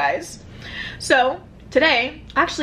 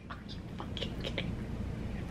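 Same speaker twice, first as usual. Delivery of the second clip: breathless laughing; 20 dB quieter, slower than usual. Retold or imitated. Laughing quote of own reaction.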